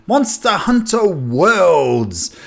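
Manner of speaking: high, drawn-out excited title reveal. Real, not acted. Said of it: "just a bit excited today".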